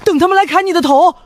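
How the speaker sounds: high-pitched disbelieving question; incredulous. Acted. Incredulous at plan to wait.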